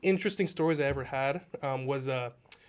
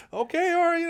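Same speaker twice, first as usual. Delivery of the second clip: higher, startled okay; mock surprised; 12 semitones higher than usual. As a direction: react as if caught off guard, much higher. Agent reacting to odd client.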